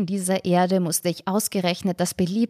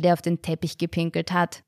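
usual speaking voice

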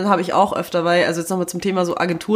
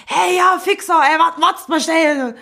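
asozial rufen; 10 semitones higher, 5 dB louder, slower than usual. Retold or imitated. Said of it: "ich red asozial". (derber Zuruf an [name], hoch und laut)

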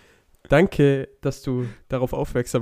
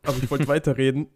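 usual speaking voice

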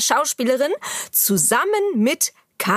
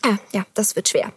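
usual speaking voice

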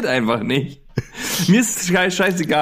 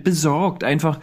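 ordinary delivery

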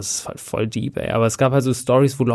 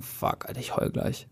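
whispered, barely voiced, with a lump in the throat; whispered inner thought; 12 dB quieter than usual. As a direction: hush it like you're about to cry. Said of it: "ich würde mir so denken". (geflüsterter Gedanke, den Tränen nah)

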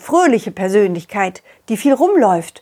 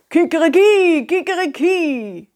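rooster crow; 6 semitones higher than usual. Acted. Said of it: "hörten wir einen Nachbarn".

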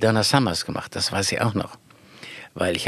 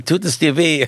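laughing mock doctor; 6 semitones higher than usual. Quoting father, laughing.

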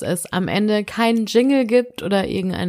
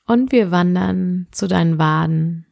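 sleep meditation; slower than usual. Acted. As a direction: guide the listener gently into sleep.

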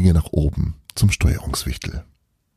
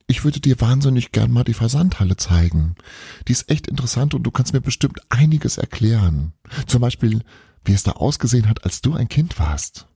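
a hint of a keen son, a little higher; lightly voiced boy, eager; 6 semitones higher than usual. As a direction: coax your dad with bright enthusiasm. Bruno voiced pleading with father.

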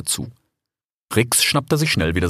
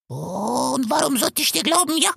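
much higher, rasping vulture voice; hoarse vulture. A narrator's reading. Vulture Bakit questioning the fox, hoarse. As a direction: croak it out like an old vulture.

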